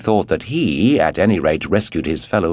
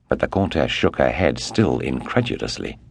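ordinary delivery

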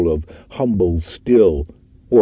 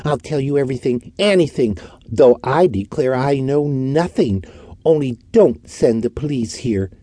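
higher, fast, begging in fear; frightened pleading; 7 semitones higher than usual. A narrator's reading. Orton begging the detective not to call police, frightened. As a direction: plead desperately, terrified. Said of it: "he pleaded in a frightened voice".

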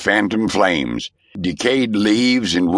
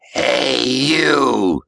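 a hint of a worn old man's voice; lightly voiced old spirit calling out; old ghost hiss; 5 semitones higher than usual. A narrator's reading. Ghost startles Roan in the cabin, a hint of an old man's voice. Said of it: "an old, worn voice hissed".

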